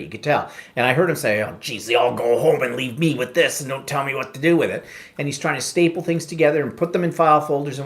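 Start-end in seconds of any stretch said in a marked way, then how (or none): 1.4-4.8 imitating a put-upon clerk's grumbling; frustrated grumble. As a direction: complain to yourself, mildly exasperated, as Jack. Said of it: "he's getting frustrated".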